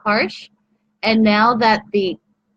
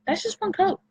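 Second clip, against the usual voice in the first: much higher and softer, gushing; high soft repeat; 11 semitones higher, 6 dB quieter than usual. Real, not acted. Repeats the phrase, pitched up.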